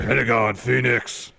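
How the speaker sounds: roughed-up, forceful name call; gruff wrestler voice. Acted. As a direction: bellow the names in a gruff voice. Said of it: "Rough it up even more".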